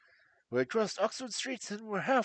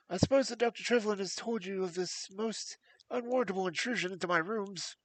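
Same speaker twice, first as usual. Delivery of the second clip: higher, anxious voice of a frightened man; nervous fat patient; 5 semitones higher than usual. Acted. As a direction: plead with jangled nerves. Blessington speaks to Holmes, agitated.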